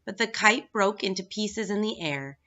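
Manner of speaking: plain reading voice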